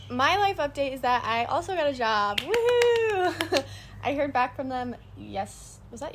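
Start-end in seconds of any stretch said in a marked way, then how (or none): 2.4-3.6 high, loud woohoo breaking into a laugh; excited cheer. Real, not acted. Telling the listeners about her new job, excited cheer. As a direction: cheer for yourself like you just got the job.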